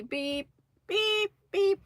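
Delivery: high, even electronic beeps; imitated scanner beep. Telling about the cashier job, high mimicked beeps.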